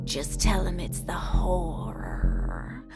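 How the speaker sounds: slow conspiratorial whisper; hushed horror-host aside. Acted. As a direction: lean in and breathe it like a secret. Hostess leans in conspiratorially.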